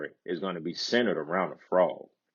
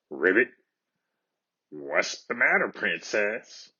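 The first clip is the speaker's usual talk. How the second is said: timid scratchy frog. A narrator's reading. Storyteller voicing the frog to the crying princess, timid and scratchy. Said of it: "timid, scratchy voice behind her say".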